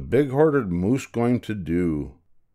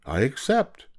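lightly delighted, pitched up; joy and delight; 6 semitones higher than usual. A narrator's reading. Spider taking up the bug's invitation, delighted. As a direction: accept with a touch of glee. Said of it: "with joy and delight".

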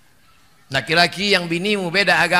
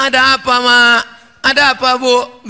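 loud, higher "Ada apa, Mak?"; loud calling; 6 semitones higher, 8 dB louder than usual. Retold or imitated. Preacher asking the grieving mother loudly what is wrong. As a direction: call out to the upset mother.

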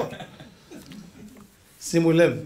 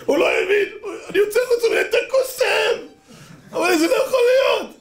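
laughing hard. Real, not acted. Teller overcome retelling the triumph.

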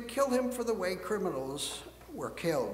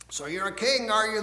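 loud mocking taunt, rising higher on the question; jeering; 4 semitones higher, 6 dB louder than usual. Roman soldiers taunt Jesus about being king, loud jeering. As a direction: sneer the question at him, louder. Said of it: "the Roman soldiers jeered".

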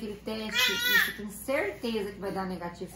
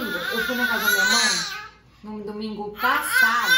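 louder and higher, half laughing as she corrects herself; laughing exasperation; 6 semitones higher, 8 dB louder than usual. Real, not acted. Correcting her husband about the day, laughing loudly and exasperated. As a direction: blurt it out through a laugh, much louder.

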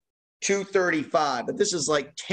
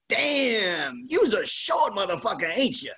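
high, hammed-up Arkansas accent; big Southern punchline impression, pitched way up; Lee Smith; 7 semitones higher, slower than usual. Towering pitcher looking down at TV guy, imitated Arkansas accent. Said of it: "Lee in his Arkansas accent".

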